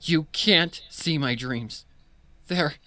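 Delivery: trembling voice. Acted. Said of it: "Her voice was trembling".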